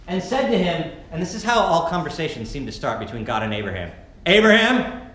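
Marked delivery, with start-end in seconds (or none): 4.3-5.0 loud, high summoning call of a name; parent calling out. Acted. God summoning Abraham like a parent, loud call. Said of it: "Parents, you know the voice I'm talking about?".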